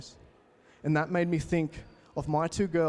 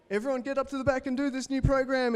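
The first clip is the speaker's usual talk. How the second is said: high, pushy salesman call; hammed-up pitch to the crowd; mock hard-sell voice shouted up high; 8 semitones higher than usual.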